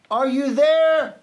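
high, loud, drawn-out call. A narrator's reading. Elephant searches clovers for his friends, calling out. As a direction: call slowly across a wide field. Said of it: "searched them and called".

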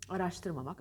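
ordinary delivery

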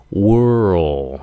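World slowly as wer-rl; drawn-out R-L. Shown as a demonstration. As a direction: stretch "World" through r into a dark l. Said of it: "cái xong rồi ồ".

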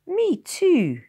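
put-on very high, loud child's cry; eager cry. A narrator's reading. Boy chiming in from the doorway, crying out. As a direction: burst in loudly and high-pitched. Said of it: "cried a voice behind him".

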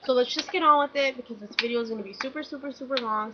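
rushed, rapid delivery; fast talking. Real, not acted. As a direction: rattle the words off quickly. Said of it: "I'm gonna try to talk as fast as I can".

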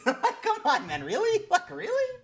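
high, disbelieving come on; incredulous. Real, not acted. Streamer reacting to an absurd appeal, high and incredulous. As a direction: squeak out your disbelief.

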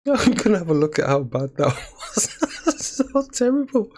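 high, loud laughter through the words; can't stop giggling at the mistake; laughing. Real, not acted. Player laughing while checking how bad the move was. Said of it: "but I am laughing 'cause that was so awful".

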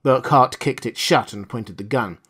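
usual speaking voice

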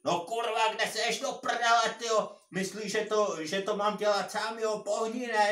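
angry high-pitched shouting, loud and insistent; yelling. Retold or imitated. Older tractor driver yelling at his young co-worker, angry shout. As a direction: bellow it like an irate boss. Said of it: "jo, doslova řval".

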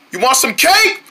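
ordinary talk

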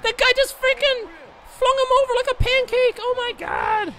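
very high, loud astonished cry; shocked shriek. Real, not acted. Gamer shrieking at a huge throw on screen. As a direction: shriek in disbelief.

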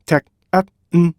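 셋, 택, 앳, 음, 제 as five separate beats; staccato syllable-by-syllable demo; syllables split apart. Shown as a demonstration. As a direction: break it into five evenly timed syllables. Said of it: "딱딱딱 음절을 정확하게 분리".

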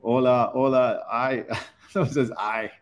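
usual speaking voice

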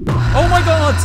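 sudden shriek of real fright, high and loud; terrified scream. Real, not acted. Gamer screaming at the ghost jump scare, terrified shriek.

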